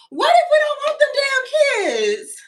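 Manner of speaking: high, loud whispered shriek; a loud airy squeal of protest; mock shout. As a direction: shriek it in a hoarse stage whisper. Mock outburst about not wanting kids.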